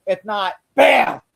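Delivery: slow threat bursting into a loud bam; mock gunshot threat. Retelling the gunman's threat in the office, mock shouted bang. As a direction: draw it out, then shout the bang.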